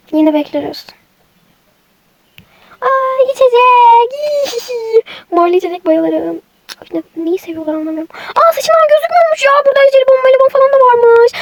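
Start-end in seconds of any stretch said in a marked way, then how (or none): 2.8-4.6 delighted surprise. Acted. Child spots a drink in shop. 8.3-11.3 high, quick excited chatter; thrilled kid discovering candy in the shop; excited child. Acted.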